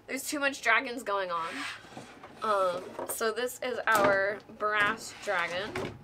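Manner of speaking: ordinary delivery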